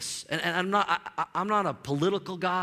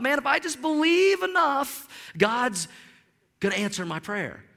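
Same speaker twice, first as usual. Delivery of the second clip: voiced believer; 7 semitones higher, 3 dB louder than usual. Grandmother's hopeful belief voiced.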